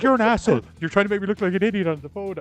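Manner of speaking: indignant accusation. Retold or imitated. Friend embarrassed on the phone, indignant. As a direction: imitate an offended friend having a go. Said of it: "She was like".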